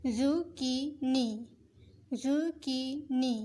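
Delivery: ज़ुकीनी said slowly with a clear k sound; slow ज़ुकीनी, crisp k in the middle; careful slow pronunciation. Shown as a demonstration. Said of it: "काफ की आवाज़ निकालेंगे". (ज़ुकीनी धीरे, साफ़ क की आवाज़ के साथ)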